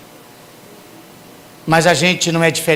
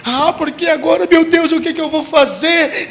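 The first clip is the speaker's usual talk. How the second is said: much higher, strained panicked question; desperate plea; 11 semitones higher than usual. Retold or imitated. Desperate sinner crying to God, high mock panic. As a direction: panic as if all is lost. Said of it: "não é arrependimento".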